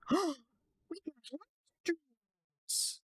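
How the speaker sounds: high, slow squeal of delight; hammed-up gasp, then squeal; mock gasp of delight. Gasps at live streams.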